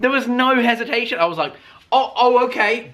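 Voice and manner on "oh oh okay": startled. Retold or imitated. Alarmed reaction to speed.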